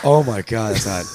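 spicy food, mouth on fire; talking through a burning mouth. Real, not acted. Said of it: "this is fucking hot".

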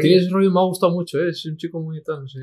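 high, gushing imitation of an older presenter; gushing praise. Host imitates a presenter praising the young singer, gushing and high. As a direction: raise the voice and gush over the kid. Host repeats Gonzalo Hermida quote.

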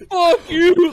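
mock crowd yell. Crowd turning on the wrestler, mock jeer.

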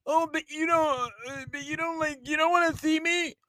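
mock whiny girlfriend. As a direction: drag it out like a sulking partner. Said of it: "girlfriend whines again".

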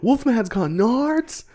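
very high, drawn-out mock kid voice; slow squeaky imitation of the line; famous movie quote. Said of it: "most famous sentence in the whole movie".

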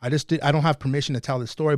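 usual speaking voice

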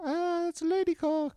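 fake girl's excuse. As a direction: bluff sheepishly in a high girlish voice. Fake girl making an excuse.

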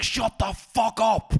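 high, slow, loud scream; furious hammed-up scream. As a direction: scream it at your granny. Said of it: "screaming at your granny".

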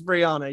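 "Brianna" with an open ah in the middle; corrected name pronunciation. Shown as a demonstration. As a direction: say "Brianna" as bree-AH-nuh. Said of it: "I kept thinking, I'm like".

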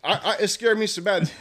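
ordinary talk

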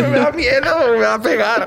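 agudo, riendo, falso susto; miedo fingido entre risas. Pretend. (miedo de broma con risa)

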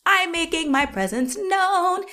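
high, slow soprano announcement; full soprano. Pretend. Kid announcing herself to the ghost in soprano. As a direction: sing it out high. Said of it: "I'm going full soprano".

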